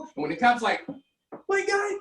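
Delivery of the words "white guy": mock cop call. Cop calling out suspect.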